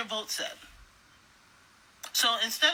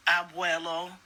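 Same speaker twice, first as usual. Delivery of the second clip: ordinary talk, nothing marked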